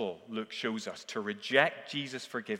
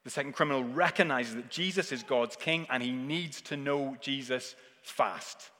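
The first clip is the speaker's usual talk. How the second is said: usual speaking voice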